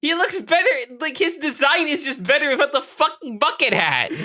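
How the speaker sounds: high-pitched, impassioned protest; worked up, voice pushed way up high; exasperated rant. Real, not acted. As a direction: argue it in a high, insistent voice. Comic rant about a hat.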